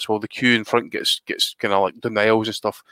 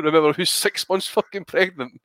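ordinary talk

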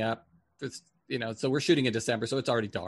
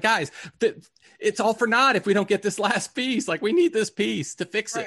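urgent rallying; 9 semitones higher, 5 dB louder than usual. Retold or imitated. Urging crew to finish.

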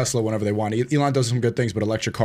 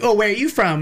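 higher-pitched retold girl voice; imitating the girls; 7 semitones higher than usual. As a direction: lightly mimic a curious girl, pitched up. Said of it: "and then they're like".

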